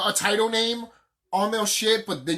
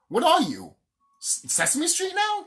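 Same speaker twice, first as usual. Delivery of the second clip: mock incredulity; 9 semitones higher than usual. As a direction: act out an incredulous, annoyed viewer. Acting out annoyed viewer's scornful question.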